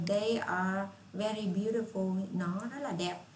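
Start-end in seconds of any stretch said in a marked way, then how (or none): 0.1-2.3 slow model sentence. Shown as a demonstration. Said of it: "Rồi".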